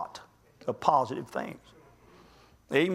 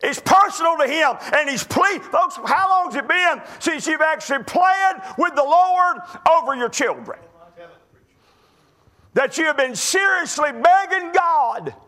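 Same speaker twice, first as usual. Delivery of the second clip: higher, loud pulpit exhortation; full-force preaching; 8 semitones higher, 4 dB louder than usual. Acted. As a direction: challenge the church with fire. Preacher exhorting congregation loudly.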